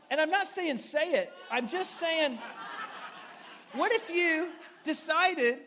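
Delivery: laughing shout. Real, not acted. Laughing clarification to congregation.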